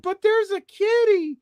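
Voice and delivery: put-on very high child voice protesting; little girl protest. Girl protesting to the haunt guide. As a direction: plead like a small girl. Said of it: "And they're like".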